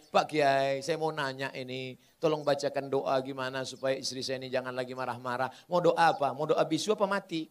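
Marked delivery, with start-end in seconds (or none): 0.1-5.5 lower, softer pleading request; a sheepish husband begging the cleric; husband quietly asks the kiai for a prayer; timid husband asking. Pretend. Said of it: "datang ke Pak Kiai".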